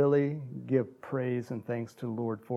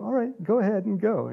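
waving him on; 7 semitones higher than usual. Retold or imitated. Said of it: "And they just kinda go".

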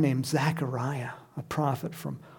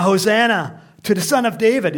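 loud, raised acclamation; imitated crowd yell; 6 semitones higher, 7 dB louder than usual. Preacher voicing the wild crowd greeting Jesus, loud raised call. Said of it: "they're yelling out".

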